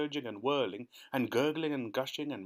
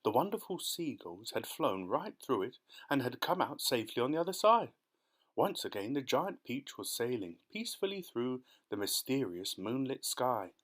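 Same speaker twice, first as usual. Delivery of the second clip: plain reading voice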